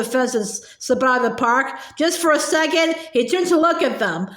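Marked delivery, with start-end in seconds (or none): none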